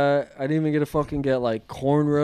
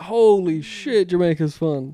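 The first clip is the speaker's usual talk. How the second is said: mock amazement; 4 semitones higher, 6 dB louder, slower than usual. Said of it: "I'm like".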